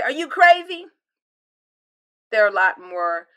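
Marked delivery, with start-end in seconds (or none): 0.0-0.9 higher, louder incredulous jab; exasperated. Retold or imitated. Disciples' unspoken thought to Jesus, exasperated. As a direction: throw the question back in disbelief. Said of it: "I know they really want to say".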